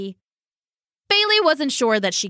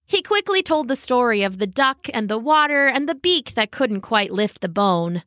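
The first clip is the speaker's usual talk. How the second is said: usual speaking voice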